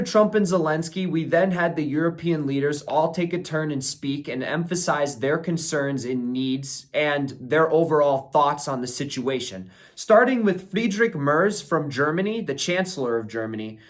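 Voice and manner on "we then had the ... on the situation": ordinary talk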